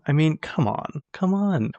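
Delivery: gushing with real fan excitement; excited. Real, not acted. Fan praising her favourite actress, high and excited. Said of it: "gushing about loved actress".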